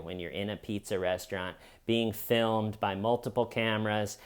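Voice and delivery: ordinary talk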